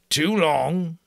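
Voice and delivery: slow, mildly sighing repetition. Acted. Giant resigned to the silly password, slow mild sigh. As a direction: repeat it slowly, giving in. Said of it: "he sighed presently".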